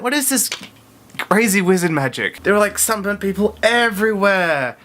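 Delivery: high-pitched, gushing disbelief; incredulous amazement. Real, not acted. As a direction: marvel at it as if it were sorcery. Incredulous about not getting burnt.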